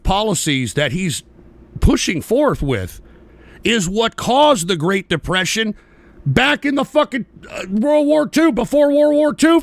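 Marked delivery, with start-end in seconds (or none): 6.2-9.6 heated shout. Real, not acted. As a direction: yell it out, exasperated. Angry rant about tariffs history.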